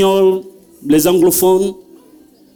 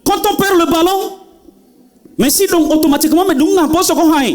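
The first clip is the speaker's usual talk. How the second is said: Baya coach shouting; 8 semitones higher, faster than usual. Retold or imitated. Coach shouting at the boy who lost the ball, high Baya French. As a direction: bark it like a village coach. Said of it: "le coach crie".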